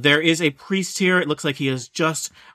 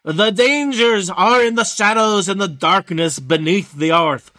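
raised, ringing pulpit delivery, key words stretched; priest's sermon; 4 semitones higher, 3 dB louder, slower than usual. Acted. Baby-faced priest warning miners at the church, sermon voice. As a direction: preach it with solemn, swelling emphasis. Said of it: "he is giving a sermon".

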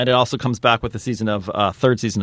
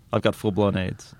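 low, softer deadpan; Liam Neeson; 3 semitones lower, 5 dB quieter than usual. Retold or imitated. Serious actor opens an improv scene as patient, deadpan. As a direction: imitate a grave actor, utterly serious. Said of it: "he's always really serious".

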